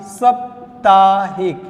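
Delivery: साप्ताहिक sung slowly, long saa held; sing-song dictation. Shown as a demonstration. Teacher to class, chanting the correct word. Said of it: "आइए साप्ताहिक".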